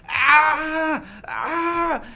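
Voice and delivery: high yelled cries of pain; pained scream. Retold or imitated. Man in agony walking to the ambulance, pained scream. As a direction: scream on every step. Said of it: "He was screaming".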